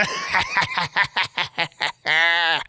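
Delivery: a low, unhinged laugh; mad laugh. A narrator's reading. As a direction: laugh like a man losing his mind. Madman laughs after confession.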